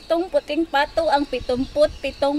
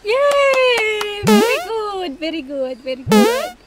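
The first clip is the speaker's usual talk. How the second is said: excited praise; 7 semitones higher, 5 dB louder, slower than usual. Real, not acted. Praising a success.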